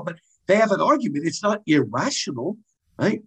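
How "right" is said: ordinary delivery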